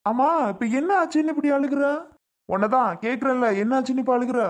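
high, loud rebuke; angry scolding. Acted. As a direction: bark at her to explain the tears. Husband scolds crying wife. (கோபமாக அதட்டுதல்)

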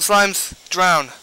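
ordinary delivery

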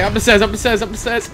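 ordinary delivery

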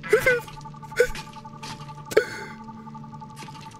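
soft unvoiced laughter; breathy laugh. Real, not acted. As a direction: laugh quietly to yourself. Laughing after reunion.

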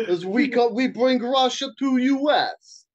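hammed-up Russian accent, haggling. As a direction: put on a comic Russian accent. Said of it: "I could do a good Russian accent".

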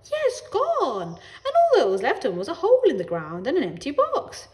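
relate the pet's death with great drama; storytelling neighbour. A narrator's reading.

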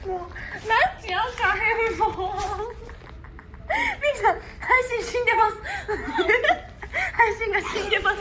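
loud, high, tearful laughing. Real, not acted. Host announcing the stream is dead, crying with laughter. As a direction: gasp it out between laughs.

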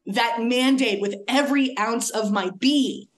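ordinary talk, nothing marked

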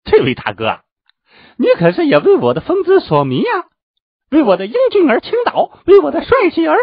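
very high, loud gushing squawk; overjoyed preening. Acted. Vain disciple meets a supposed admirer, ecstatic boasting. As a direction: gush in rapture about your own charm. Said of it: "他眉飞色舞，".

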